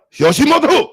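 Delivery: put-on high, loud, angry caricature Japanese accent; shouted hammed-up Japanese impression; mock Japanese accent. Streamer trying Japanese for chat, shouted angry mock accent. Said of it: "I wanna get the Japanese one".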